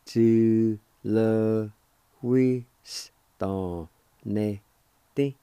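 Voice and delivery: Tu, le, wi, s, don, ne, este given as separate punched syllables, starting loud. Shown as a demonstration. Teacher breaking down a long word for students, syllable by syllable. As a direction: chop the word into clear beats. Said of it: "It's pronounced like this".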